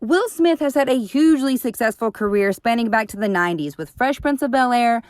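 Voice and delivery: ordinary talk, nothing marked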